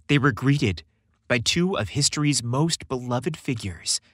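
usual speaking voice

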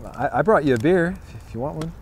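ordinary talk, nothing marked

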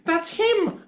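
put-on high-pitched child's exclamation; child's excited cry. A narrator's reading. Child telling Mummy the beast has spoken, excited cry. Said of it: "I cried".

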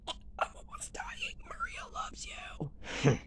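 high, whispered sobbing plea; dying friend sobbing a plea; crying whisper. Acted. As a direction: beg through tears, barely audible.

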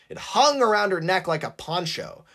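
ordinary delivery

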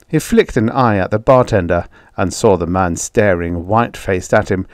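ordinary delivery, nothing marked

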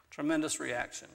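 ordinary talk, nothing marked

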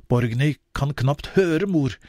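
plain reading voice

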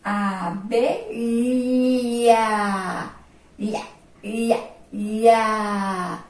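ia, ia, ia drawn out like a teacher; sound drill. Shown as a demonstration. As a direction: repeat ia, stretching it for the kids. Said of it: "Por isso, ó".

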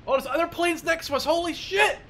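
sudden high startled cry; real shock at planes close by. Real, not acted. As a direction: jolt up in real surprise.

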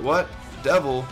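ordinary talk

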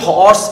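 হর্স with a clear breathy h at the start; হর্স with the h pronounced; sounded h. Shown as a demonstration. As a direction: give হর্স its h. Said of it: "এইচ এর উচ্চারণ হচ্ছে কি?".

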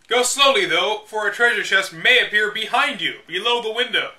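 measured, deliberate strategy-guide narration; guidebook read-aloud. Acted. Reading old game guide to viewers, deliberate. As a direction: read it like the official manual, step by step.

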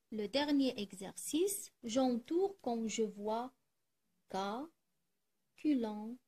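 ordinary delivery

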